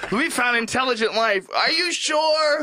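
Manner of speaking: imagined scientist over the moon camera; mock excitement. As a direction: announce the find in hammed-up excitement. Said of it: "He'd be like".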